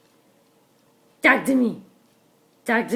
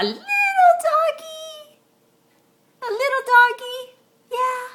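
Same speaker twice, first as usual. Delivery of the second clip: much higher little-voice for the little doggy; tiny squeaky voice; 13 semitones higher than usual. Pretend. Contrasting big and little doggy.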